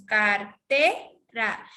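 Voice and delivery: Car-TE-ra in three separate steps; up, then down. Shown as a demonstration. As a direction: climb to TE in Cartera, then drop for ra. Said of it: "Y luego bajamos".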